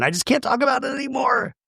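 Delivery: high, strained voice, imitating tears; a quick mock of someone on the verge of crying; retold crying. Emily breaking down to Shane, mock tearful. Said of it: "And then she starts crying".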